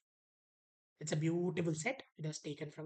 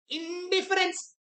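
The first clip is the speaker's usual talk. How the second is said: announced option; 11 semitones higher, 8 dB louder, slower than usual. Shown as a demonstration. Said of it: "What does indifference means".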